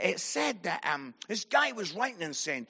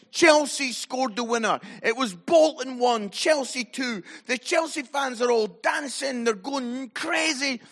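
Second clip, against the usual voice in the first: ordinary talk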